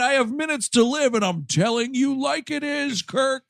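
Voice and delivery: high, mock Scotty voice cursing out Kirk; ranting dying Scotty. Scotty telling Kirk off before he dies. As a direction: let loose like a man with minutes left.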